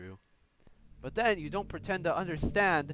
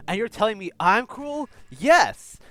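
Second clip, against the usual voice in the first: louder, higher outraged shout; indignant yell; 4 semitones higher, 11 dB louder than usual. Real, not acted. Fan yelling agreement at the scene, outraged. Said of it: "after yelling all that shit".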